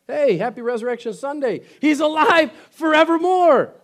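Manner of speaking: preacher cheering on Resurrection Sunday; joyful exclamation. Real, not acted. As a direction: celebrate with the congregation. Easter exclamation.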